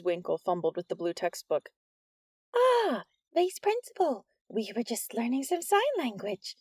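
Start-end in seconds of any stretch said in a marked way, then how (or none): 2.5-6.6 high, startled teacher voice; nervous high teacher; flustered teacher. A narrator's reading. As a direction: squeak it out caught red-handed.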